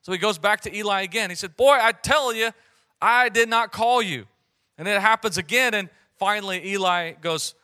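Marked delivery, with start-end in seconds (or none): 1.5-4.3 higher and much louder, fed up; exasperated scolding. Retold or imitated. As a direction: scold the boy, at the end of his patience. Eli woken again, exasperated.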